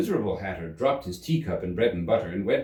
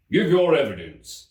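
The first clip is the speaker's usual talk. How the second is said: louder, higher command; commanding King; 7 semitones higher, 7 dB louder than usual. Acted. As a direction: summon the next witness.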